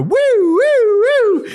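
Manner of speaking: loud, high siren whoops; imitated bullhorn siren. Imitating the co-host's bullhorn aimed at the guest, siren whoops. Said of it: "pulls out a bullhorn".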